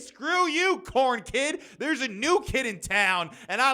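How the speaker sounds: high, loud playful jab; a playful shouted diss; mock outburst. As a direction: shout it at the corn kid in jest. Mock outburst at corn kid.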